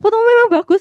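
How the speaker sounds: really mean the praise; high, loud "Fotomu memang bagus"; enthusiastic praise. Retold or imitated. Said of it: "Nggak bilang".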